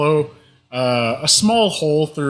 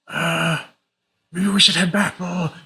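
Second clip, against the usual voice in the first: nervous bandit; 3 semitones higher than usual. Acted.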